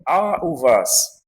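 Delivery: a u vas, high start landing firmly on vas; formal a u vas. Shown as a demonstration. Teacher repeats the formal question to students, respectful. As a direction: ask it respectfully. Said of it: "vas é a maneira formal".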